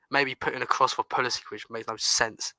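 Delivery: ordinary talk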